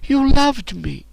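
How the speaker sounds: high hurt plea; pleading girl. A narrator's reading. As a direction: remind her, high and pleading. Captive pleads with former slave.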